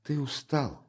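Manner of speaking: meek. Acted. Sister excusing her brother's words, meekly. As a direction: back down meekly, softly. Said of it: "кротко сказала она".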